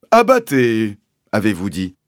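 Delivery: "Abattez" with B for P, loud then easing; P turned to B. A narrator's reading. Sergeant querying the landowner, "Abattez" pronounced with B for P. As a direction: lean on "Abattez", swapping P for B. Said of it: "remplacer les P par des B".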